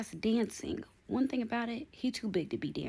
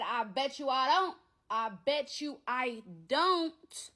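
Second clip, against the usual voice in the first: louder, higher, slowing down on the second one; defiant comeback; 6 semitones higher, 4 dB louder, slower than usual. Retold or imitated. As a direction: bet him, daring him to try. Talking back to the ex.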